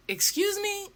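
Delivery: high, soft, offended "excuse me"; acting out being taken aback; incredulous. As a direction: pitch shoots up in quiet disbelief. Taken aback by driver's refusal.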